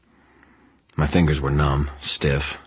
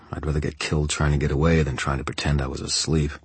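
ordinary delivery, nothing marked

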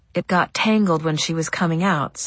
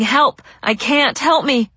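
higher, louder cry for help, held back; retold shouting; 7 semitones higher, 5 dB louder, slower than usual. Ma tells her child how she begged for help, retold shout. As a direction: retell a desperate shout without full volume. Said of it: "I was shouting".

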